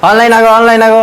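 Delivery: high, loud mock Bengali call; misheard shout. The comic repeats the crowd's call as he misheard it, mock shout. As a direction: belt out onlain a go as he misheard it.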